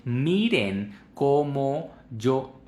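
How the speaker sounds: slow, drawn-out, overdone enunciation; over-enunciate every sound slowly; exaggerated articulation. Shown as a demonstration. Teacher showing Spanish mouth movement, exaggerated articulation. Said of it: "obviamente estoy exagerando".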